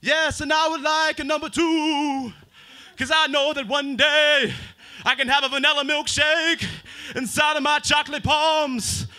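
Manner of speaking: slow, high, loud preaching cadence; Martin Luther King. Retold or imitated. Martin Luther King ordering at a McDonald's drive-thru, slow booming oratory. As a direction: orate a fast-food order like a speech. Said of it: "This is Martin Luther King Junior at a drive-thru".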